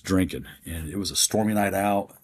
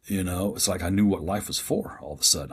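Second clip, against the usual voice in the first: normal talking voice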